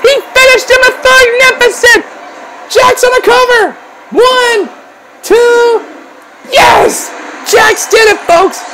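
high, sustained shouted play-by-play; frenzied excitement. Real, not acted. Commentator calling Jax's winning cover to fans, frenzied excitement. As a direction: urge on the finish and exult.

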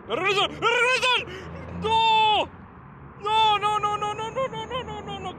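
high-pitched shout tumbling into rapid-fire pleading; panicked outcry. Real, not acted. Fan watching México's chance slip away, panicked outcry. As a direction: yell high in dismay, then let the repeats fade.

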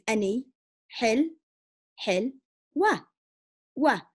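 "hil, hil, wa, wa" broken into slow separate syllables with pauses. Retold or imitated. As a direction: sound it out piece by piece, slowly. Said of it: "Slow it down".

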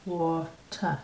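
water as an American's tentative British copy; attempted British water. Shown as a demonstration. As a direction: try water in two careful pieces with a real t. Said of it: "Did that sound even close to right".

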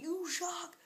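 high, drawn-out mock yell; hammed-up high-pitched outrage; mock rant. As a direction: whine an exaggerated rant at a friend. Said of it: "I went in a rant on him".

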